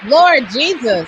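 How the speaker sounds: loud, stunned outburst; shocked exclamation. Real, not acted. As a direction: gasp out in disbelief. Reacting to the aged guest.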